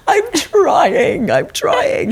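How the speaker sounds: very high strained plea; exasperated squeak. Retold or imitated. Parent pleading with the passenger in front in a very high strained voice. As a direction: squeak it out, desperate and overwhelmed. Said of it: "And I'm like".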